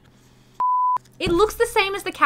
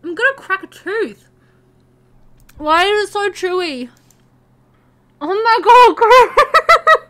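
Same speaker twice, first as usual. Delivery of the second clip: exasperated loud; 5 semitones higher, 12 dB louder, slower than usual. Real, not acted. Struggling with chewy candy.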